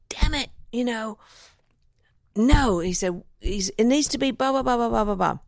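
high, breathy hissed complaint building to a sharp no; exasperated boss. Retold or imitated. Ad executive fed up with the session players, mock exasperated. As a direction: imitate a fed-up executive waving off the take. Frustrated ad exec giving orders.

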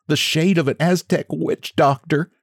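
high, nervous little man; timid little man voiced high; little man's voice. A narrator's reading. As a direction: squeak it out in fear. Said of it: "said the little man".

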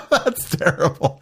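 laughing through the words. Real, not acted. Laughing at co-host's crude joke, real laughter. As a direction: giggle while protesting.

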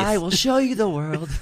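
a light nasal Aladdin croon; sing-song hint of Aladdin. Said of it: "I have an Aladdin voice".

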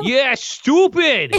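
high, loud, slow Brooklyn tough-guy accent; exaggerated Brooklyn accent. Shown as a demonstration. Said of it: "You're talking normal".

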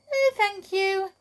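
put-on high sweet contrary girl voice; smiling. Miss Contrary thanks Mister Happy, high and falsely sweet. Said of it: "smiled little Miss Contrary".

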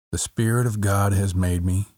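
ordinary talk, nothing marked

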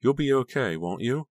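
fretful. A narrator's reading. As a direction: check on them with anxious concern. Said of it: "she said fretfully".

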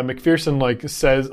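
ordinary delivery

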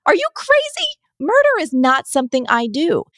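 high, loud shocked squeal; shocked disbelief. Retold or imitated. Refusing the neighbor's absurd request, shocked and shrill. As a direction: shriek it in utter disbelief. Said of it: "I'd be like".

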